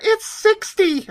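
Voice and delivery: high, slow, squealing impression of a friend; mock gushing friend. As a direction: squeal it like a smug Californian friend. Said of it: "she's like".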